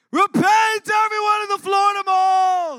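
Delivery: high, loud shouted mock warning; mock street preacher. Pastor mocking a mall preacher, hammed-up shout. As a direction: yell it at the whole mall like a doomsayer. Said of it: "This is what you're not gonna do".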